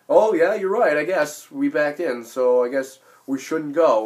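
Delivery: loud, high mock concession; sarcastic agreement. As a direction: exaggerate giving in to the critics, loudly.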